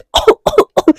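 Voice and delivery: hammed-up coughing fit; choking cough at the canteen table; acted cough. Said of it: "खांसने लगती है जोर जोर से".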